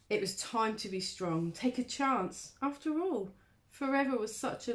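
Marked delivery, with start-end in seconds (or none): none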